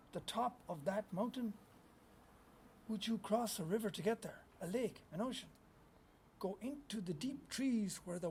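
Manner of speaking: soft, searching poem voice; hushed reflective poem reading; poetry reading. Acted. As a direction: read it gently, as questions to yourself.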